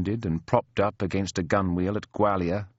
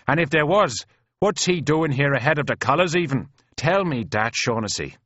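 higher, argumentative brogue; doubting Irish soldier; 6 semitones higher, 3 dB louder than usual. Acted. Mick demanding answers from Shaughnessy, doubtful. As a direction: challenge him in an Irish voice.

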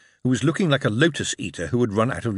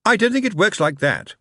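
put-on boy's voice; 10 semitones higher, 4 dB louder than usual. A narrator's reading. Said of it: "The elder boy said".

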